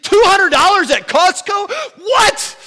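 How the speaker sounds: like reading a shocking receipt aloud; outraged disbelief. Retold or imitated. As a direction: shriek the price in outrage. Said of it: "I'd go to the next receipt".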